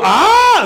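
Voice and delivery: high, loud hammed-up cry; mock scream. Parent caught by the subscription news, loud high mock scream. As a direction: let out a sudden high mock yell.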